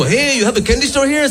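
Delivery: very high silly voice; exaggerated candy-seeker. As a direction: pitch it way up, overeager and silly. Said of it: "Sounds like I'm stuffing pinatas".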